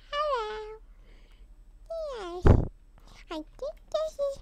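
soft, lightly put-on cute voice; a hint of cutesy sweetness; cutest voice. Shown as a demonstration. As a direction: try out a dainty little voice. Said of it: "let's see in my cutest voice".

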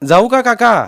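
high, loud question; calling out. A narrator's reading. Boy asking uncle if he may go, calling out. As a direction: call across for permission. Nephew calls goodbye across stream.

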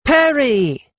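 high-pitched glad exclamation; exclaiming. A narrator's reading. Lettuce catching sight of her younger friend, exclaiming. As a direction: call out on spotting a friend. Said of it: "she exclaimed".

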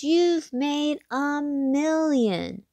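ordinary delivery

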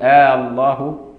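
opening آ of الله drawn out long; long "aaa" before the doubled l of الله; lengthened oath hamza. Retold or imitated. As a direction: stretch the first vowel in "آه الله" as in swearing an oath. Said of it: "ما ينفعش يمد همزة".

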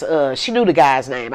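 ordinary delivery

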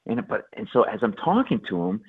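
ordinary talk, nothing marked

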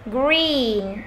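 "Green" with a drawn-out ee; long ee. Shown as a demonstration. Teacher pointing out the long vowel, stretched "Green". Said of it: "Y dài đúng không nào".

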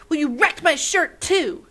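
high, loud angry shout. Acted. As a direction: shout it back at her. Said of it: "shouted Torin".

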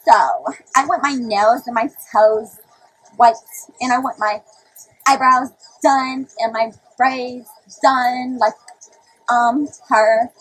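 slow, loud parody with drawn-out words; put-on prissy, halting delivery; mock prissy talk. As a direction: stretch each item out, mocking how they want you to talk. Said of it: "what y'all want me to be like?".

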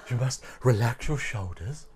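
lightly put-on yoga teacher; mock relaxation coach, a little drawn back; yoga instructor. Recalling the yoga moment, mild instructor voice. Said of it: "I just got all yoga then".

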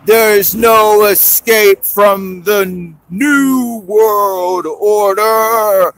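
one-eyed alien popping out with a growl. Acted. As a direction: drag the words out like a menacing monster. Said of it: "and growls".

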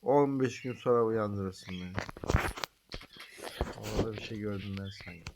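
sleepy slurred voice; drowsy, half asleep; nodding off. Real, not acted. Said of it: "Benim iyice uykum geldi".

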